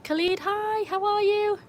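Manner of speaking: high-pitched, delighted hello; excited greeting. Real, not acted. Streamer welcomes a viewer to the chat, high excited greeting.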